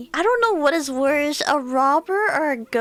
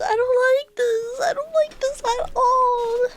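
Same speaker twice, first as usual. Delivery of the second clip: higher and more tearful each time; frightened whimper, close to crying; 6 semitones higher than usual. Real, not acted. Figure appears, she whimpers.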